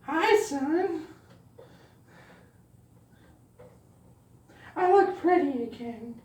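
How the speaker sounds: high falsetto of someone posing as mother; pretend mom. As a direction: put on a high mock-mother voice. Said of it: "dressing up like your mom".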